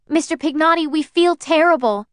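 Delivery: high, loud and pleading; almost in tears, begging forgiveness; pleading apology. Acted. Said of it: "I said into the mouthpiece".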